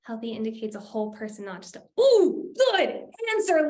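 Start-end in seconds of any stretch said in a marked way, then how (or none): 2.0-3.7 higher, louder burst of approval; an excited, drawn-out cheer for a good answer; delighted praise. Real, not acted. Host praising an attendee's chat answer, delighted.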